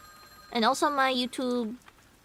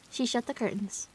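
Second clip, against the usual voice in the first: ordinary delivery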